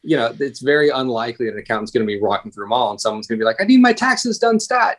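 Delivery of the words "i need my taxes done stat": much higher, a frantic bystander begging for taxes; hammed-up panicky stranger, pitched way up; mock urgent demand. Joking about a mall emergency for accountants, mock frantic high plea.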